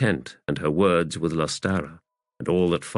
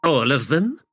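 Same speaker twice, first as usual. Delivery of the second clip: higher, rising in surprise; startled question; 7 semitones higher than usual. A narrator's reading. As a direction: ask it taken aback. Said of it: "in a startled voice asked".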